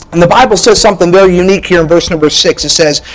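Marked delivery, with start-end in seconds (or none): none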